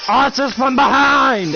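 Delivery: high, loud, slow shouted order; commander's battle cry. Pretend. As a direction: bellow the order to the troops. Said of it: "And then it's like".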